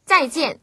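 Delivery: 再 and 见 both fall from high; two separate high drops on 再见; double falling tone. Shown as a demonstration. Teacher models tones for learners, 再见 both falling. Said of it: "두 번 다 떨어지기 때문에".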